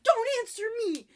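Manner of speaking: high, loud frantic command; frantic girl hushing him on phone; frantic shout. Retold or imitated. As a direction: yell it in a panic. Frantic character.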